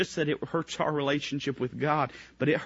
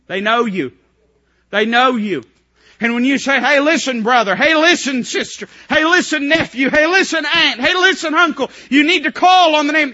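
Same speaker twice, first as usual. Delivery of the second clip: higher, louder, building preacher's shout; full-force preaching; 12 semitones higher, 11 dB louder than usual. Acted. Preacher voicing a believer calling to family, building shout. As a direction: build it up, calling out each relative.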